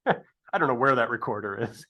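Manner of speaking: laughing through the words; amused laugh breaking into the line. Real, not acted. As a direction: chuckle while admitting it. Laughing at own failed recorder.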